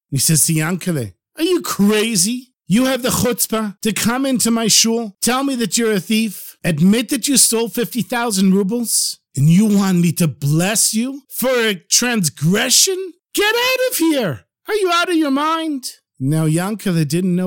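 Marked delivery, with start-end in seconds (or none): 1.4-13.1 raised, incredulous telling-off; indignant scolding. Acted. Rabbi scolds the confessing thief. 13.3-16.0 very high, outraged dismissal; outraged yell. Acted. Rabbi throws the thief out.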